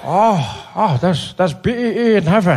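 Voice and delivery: put-on groaning, halting old man's voice; Nelson Mandela. Old leader believing driver is in heaven, dazed. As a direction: stretch the words in dazed wonder.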